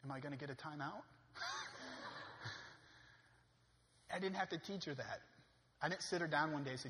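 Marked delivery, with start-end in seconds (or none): 1.3-2.7 breathy laugh. Real, not acted. Laughs at daughter's line.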